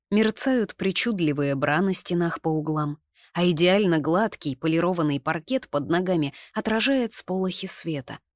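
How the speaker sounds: ordinary delivery, nothing marked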